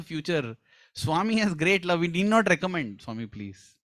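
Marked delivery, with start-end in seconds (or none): 3.0-3.8 low hushed begging; soft plea. Retold or imitated. Devotee softly imploring Swami, low and hushed. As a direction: murmur it like a small prayer. Mock prayer of recommending.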